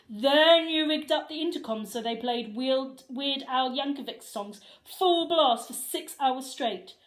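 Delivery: loud, stern lecture; scolding principal. Acted. Principal listing the boys' pranks, loud stern scolding. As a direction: list every crime, building to triumph.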